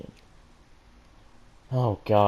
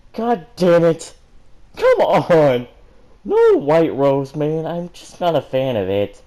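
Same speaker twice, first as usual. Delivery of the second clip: loud, high, slow exasperated yell; frustrated outburst; 6 semitones higher, 8 dB louder, slower than usual. Real, not acted. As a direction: vent at the story. Reader frustrated by ship pairing.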